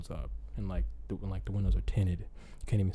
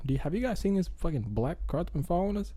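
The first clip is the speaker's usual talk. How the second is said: worried family; 8 semitones higher, faster than usual. Retold or imitated. Family voiced asking about car.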